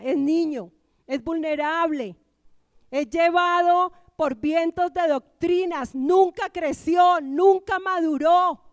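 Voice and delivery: loud, high, slowing to hammer each phrase; forceful preaching. Real, not acted. Preacher warning about the believer who stays a child, forceful preaching. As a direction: build to a drawn-out preacher's shout.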